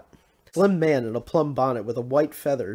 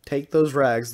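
mild scream; 2 semitones higher, 6 dB louder, slower than usual. A narrator's reading. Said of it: "the blonde woman screamed".

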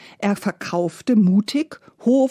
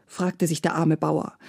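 ordinary delivery, nothing marked

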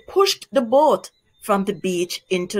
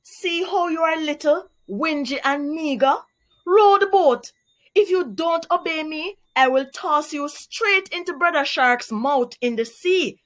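threatening; 6 semitones higher than usual. Acted. Anansi bullying little Shrimp, higher bossy threat. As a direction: lean on him and bully. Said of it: "threatened Anansi".

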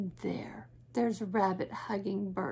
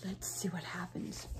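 whisper; 4 semitones lower, 13 dB quieter than usual. Real, not acted.

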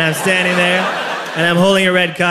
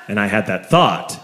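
ordinary talk

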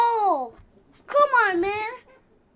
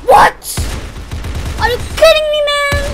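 outraged shout; 11 semitones higher, 10 dB louder, faster than usual. Real, not acted. Gamer outraged at death.

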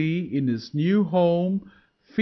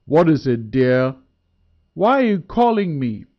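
gruff voice; 5 dB louder than usual. A narrator's reading. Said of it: "said in a gruff voice".

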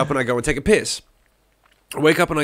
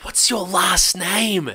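stunned question; 5 semitones higher, much slower than usual. Retold or imitated. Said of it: "I was like".

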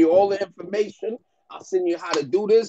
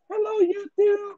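mocking a bassless YouTuber's hello; voice with no bass. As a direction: mimic him, much higher and thin, no bass at all. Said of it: "No bass in his voice".

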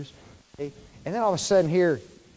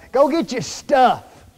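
louder, slow mock parent calling out go get your stuff; parent's order; 5 semitones higher, 6 dB louder, slower than usual. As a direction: bark it like a mom sending a kid to pack. Parent telling child before trip.

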